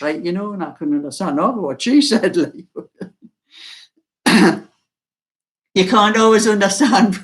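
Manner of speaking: ordinary talk, nothing marked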